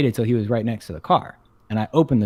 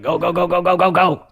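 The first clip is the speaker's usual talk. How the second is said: higher, rapid-fire repeated command; frantic urging; 6 semitones higher, 4 dB louder than usual. Retold or imitated. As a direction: urge a getaway in a rough, raised voice. Retold panicked getaway urging.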